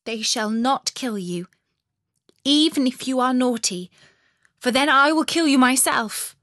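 half a promise, half a threat; serious robber girl. A narrator's reading. Robber girl seriously promises Gerda protection, then threatens her. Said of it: "nodding very seriously, saying".

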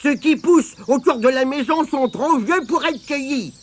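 put-on high, piercing old woman giving orders; piercing granny voice. A narrator's reading. Mémé Croche rousing Tirondin to fetch mushrooms, piercing. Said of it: "la voix perçante de Mémé Croche".

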